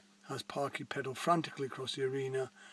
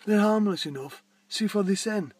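lightly voiced young man, higher and louder; a hint of a different voice, a little raised; snake man; 6 semitones higher, 8 dB louder than usual. Snake owner reassures park warden, lightly voiced and higher.

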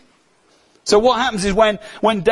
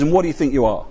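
usual speaking voice

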